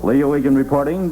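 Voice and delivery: ordinary delivery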